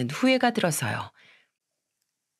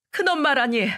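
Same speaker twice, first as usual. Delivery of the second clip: scolding; 7 semitones higher than usual. Retold or imitated. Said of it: "혼을 냈습니다".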